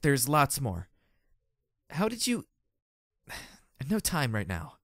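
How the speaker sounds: tired exasperation; exasperated groan. A narrator's reading. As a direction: sigh it out, fed up. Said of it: "I groaned".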